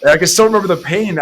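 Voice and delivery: ordinary talk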